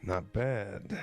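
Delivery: low appreciative mock-dad voice; leering dad. Dad eyeing a girl in boots, mock leering. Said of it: "He's publicly horny".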